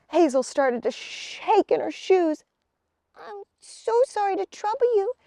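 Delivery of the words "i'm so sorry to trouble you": put-on nervous girl. A narrator's reading. Hazel nervous.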